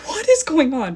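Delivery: high, loud freaked-out disbelief; retold bewilderment. Woman whose initials a stranger guessed, acted alarmed disbelief. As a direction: act out baffled alarm.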